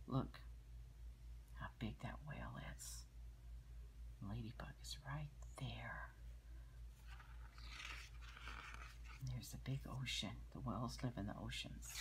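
soft hushed aside; whispering. Real, not acted. Whispering about picture to child.

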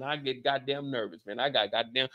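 normal talking voice

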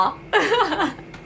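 a short, soft giggle; soft delighted laugh. Real, not acted. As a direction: let a little laugh out. Laughing at gift. (หัวเราะเบาๆ)

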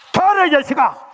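high, loud furious yell at her son; angry mother's yell. Retold or imitated. Mother catching her son sneaking home, loud furious yell. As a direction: bellow it like a mother at the end of her rope.

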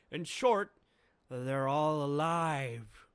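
loud, slow, drawn-out announcement; grand mind-blowing proclamation; dramatic reveal. Acted. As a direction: drop the big news slowly.

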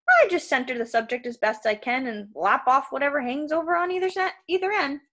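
salesman patter climbing high at the end; cheerful salesman, matter-of-fact. Acted. Krusty explaining his simple fix, cheery salesman. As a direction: explain butchery as routine service. Voices giant waterbed salesman.

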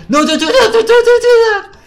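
extremely high, loud screeching protest; frantic scream. Real, not acted. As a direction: scream at a teammate to stop. Screaming at teammate throwing game.

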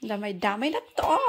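loud laugh-crying talk; laughing despair. Real, not acted. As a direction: laugh through the frustration. (malakas na salitang may tawa at iyak)

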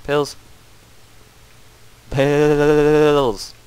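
loud, drawn-out call. Real, not acted. Gamer calling teammates over to the pills, loud call. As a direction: call the find to the team.

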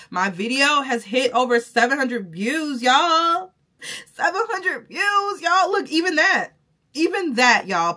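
squealing with real joy, high and loud; can't believe it, bursting with excitement; near-tearful happy shouting; overjoyed. Real, not acted. Excited about seven hundred views.

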